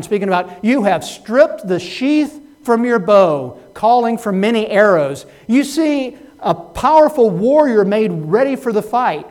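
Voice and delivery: loud, high, emphatic pulpit delivery; full-force preaching. Real, not acted. Preacher declaring God's power from scripture, loud impassioned preaching. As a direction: proclaim the verses forcefully to the congregation.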